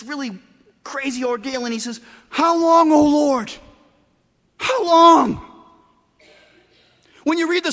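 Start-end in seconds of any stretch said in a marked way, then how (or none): 2.3-5.4 loud, high, drawn-out plea; full-voiced lament, pitched high; anguished cry to God. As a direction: cry out to heaven in desperation. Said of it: "read it like that".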